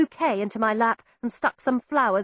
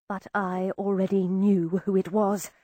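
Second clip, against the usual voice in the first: plain reading voice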